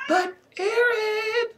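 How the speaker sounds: very high, drawn-out wail; shrill squealed protest; whining protest. A narrator's reading. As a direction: whine the name in frustration. Lucy protests to dismissive friend.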